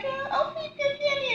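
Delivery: put-on high, squeaky toddler voice; little child voice. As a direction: play the excited four-year-old. Voicing a little four-year-old.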